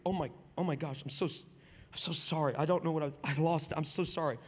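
low, soft, stammered apology; sobered apology. Retold or imitated. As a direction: apologise quietly, anger suddenly gone. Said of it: "She immediately sobers out of her anger".

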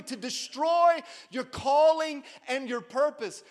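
high, loud preacher's shout; full-force sermon cry; shouted preaching. Acted. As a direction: drive it home to the congregation.

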